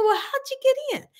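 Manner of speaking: high, baffled question; retold wonder, high pitched; puzzled. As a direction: ask the groundhog in disbelief. Wondering at groundhog in yard.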